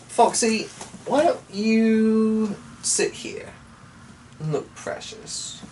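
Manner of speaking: pet-talk voice. Real, not acted. Speaking sweetly to his pet.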